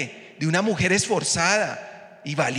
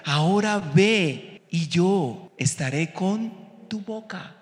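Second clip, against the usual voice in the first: slow emphatic reading; 3 semitones higher, slower than usual. Acted. Verse repeated slowly.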